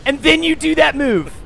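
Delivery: loud, high-pitched frustrated shouting; real shouted exasperation at the game; exasperated gamer outburst. Real, not acted. Player shouts at the opponent's move in the game, exasperated.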